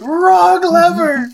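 high, drawn-out cartoonish cry; mock-dramatic yell. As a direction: wail it long and loud. Acted cry in lever joke.

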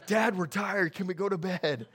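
lightly voiced tired kids begging for bed; a hint of a worn-out kid; sleepy kids. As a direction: mimic drowsy children pleading with dad. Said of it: "they're falling asleep".

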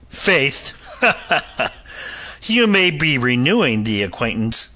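high, sneering laugh into the words; unpleasant laugh. A narrator's reading. Captain laughs unpleasantly at the doctor's claim. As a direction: laugh with nasty glee as you threaten. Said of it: "the captain laughed unpleasantly".

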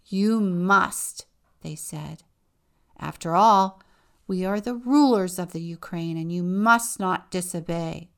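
loud overbearing order; commanding. Acted. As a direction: lord it over the daughter. Parents command their daughter.

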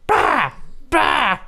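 put-on sharp, high baby cry, bah; baby wail imitation. Friend imitates the baby's cry on the flight, high sharp wail. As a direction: mimic a screaming baby on a plane.

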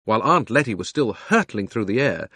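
ordinary delivery, nothing marked

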